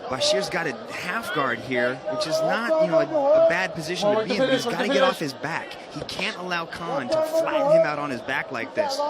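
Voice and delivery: ordinary talk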